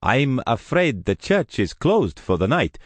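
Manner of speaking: apologetic priest. Acted. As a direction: gently turn the visitor away. Priest voiced at the door.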